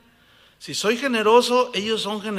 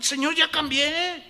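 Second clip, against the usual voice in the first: pleading believer; 9 semitones higher than usual. Acted. Believer pleads with God acted.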